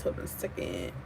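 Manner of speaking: hushed whisper away from the mic; whispering to someone off camera; whispered aside. Real, not acted. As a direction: mutter it off to the side, under the breath. Off-mic aside while checking something.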